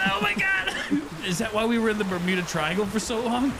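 ordinary delivery

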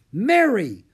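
loud, high, sharp call of the name; impatient. A narrator's reading. Augusta interrupting Mary's tale, loud and impatient. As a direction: bark her name to stop the story. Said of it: "She sounded impatient".

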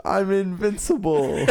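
high, loud, drawn-out cry; mock defiant boast. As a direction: declare it slowly at full voice. Acting out the knight's boast.

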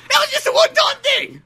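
very high, loud comic protest; mock indignant shriek. Sherlock excusing himself to Watson, shrieked mock protest. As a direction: protest in a screechy character voice. Character protesting the shower accusation.

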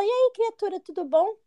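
high-pitched, breezy hello; imitated friend's greeting. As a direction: play the friend greeting on the phone. Friend's cheerful phone greeting acted. (cumprimento imitado, em tom agudo)

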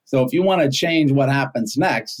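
normal talking voice

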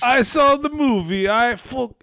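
high, loud mock Russian accent falling apart; badly faked Russian accent; botched Putin accent. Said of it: "my accent was really fucked up".

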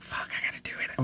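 panicked whisper to herself; urgent whisper. Retold or imitated. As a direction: hiss the thought out in panic. Panicked inner thought, retold.

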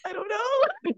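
high-pitched tearful squeak, re-enacted; playful imitation of being choked up; weepy. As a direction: wail it small, as if about to cry. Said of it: "I got a little weepy".

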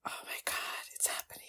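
fast, hushed whisper; awed whisper. Real, not acted. Fan seeing the awaited moment happen, hushed whisper. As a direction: whisper it quickly with no voice.

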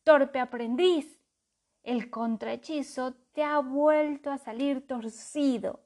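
angry scolding. A narrator's reading.

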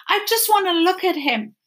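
a hint of a small boy asking to see his rabbit; pleading boy. Bryce begging the mender to see Edward, pleading.

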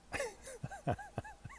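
a sudden squeaky giggle at his own joke; high giggle. Real, not acted. Vlogger giggling at his own Southern joke, high laugh. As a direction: let a high little laugh slip out.

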